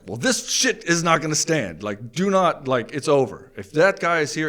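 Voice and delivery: husband laying down the law to his wife, loud and firm; forceful ultimatum. Retold or imitated.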